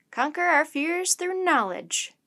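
slow, high and loud, savouring a new motto; a professor's enthusiastic, drawn-out declaration. A narrator's reading. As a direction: proclaim it grandly with rising enthusiasm. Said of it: "repeated his thought with enthusiasm".

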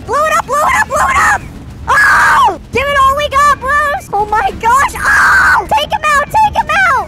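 high, loud rallying calls; excited urging shouts. Real, not acted. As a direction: cheer them on to blow it up. Urging team to blow up car.

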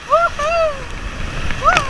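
rising into a high whoop; on a water ride. Real, not acted. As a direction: build up then shout at the drop. Approaching drop on water ride.

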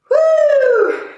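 high, loud falling whoop; excited whoop; cheering woo. Real, not acted. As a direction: cheer the class on. Cheering on the class.

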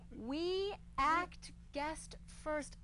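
very slow, each word set apart; word-by-word quote. Retold or imitated. Comic quoting a restaurant sign to the crowd, slow and deliberate. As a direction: read the sign out one deliberate word at a time. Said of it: "and I quote".

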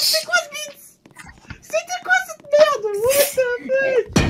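squeaky high disbelief. Real, not acted. Reacting to glitch.

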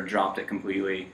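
usual speaking voice

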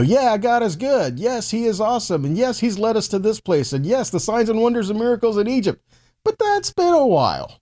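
mock grudging praise; sarcastic doubter. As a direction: sneer that it's been a while. Voicing the doubting Israelites.

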